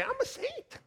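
high cheeky teenage mock voice; mock teen reply. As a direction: sass the yelling parents.

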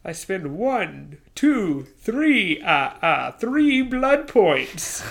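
slow, drawn-out vampire count, climbing in pitch; hammed-up vampire counting, rising and stretched at the end.

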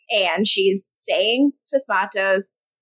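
ordinary delivery

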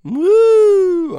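loud, high whoop; excited whoop. Real, not acted. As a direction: let out a big party whoop. Excited shout after the song.

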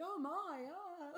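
high, breathy fake damsel gasp; mock damsel. Showing a friend how to drop earbuds, mock damsel gasp. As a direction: play the helpless damsel dropping something. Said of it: "I think you're just like".

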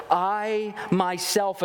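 "I myself" drawn out slowly, both words stressed; slow, weighty "I myself"; emphatic I myself. Shown as a demonstration. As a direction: linger on "I myself". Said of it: "hear this emphatic statement".

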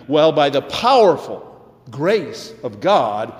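ordinary talk, nothing marked

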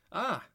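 a high, loud "ah" as the back hits the wall; sharp cry. Acted. Pinned to the wall by Reaper, a high loud yelp. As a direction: yelp out loud in pain and shock. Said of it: "I cried".

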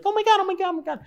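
mock high-pitched giddy squeal of "oh my God"; giddy excitement. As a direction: imitate giddy, happy relatives way up high. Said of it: "They're all giddy and excited and happy".

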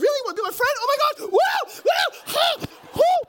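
very high, loud squeals, hammed-up; overjoyed child. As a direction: squeal like a kid with a new friend. Daughter thrilled at making a friend.

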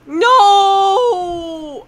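high loud mock wail; mock dismayed cry. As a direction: cry out in fake despair. Mock dismay at flower lady.

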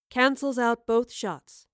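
plain reading voice

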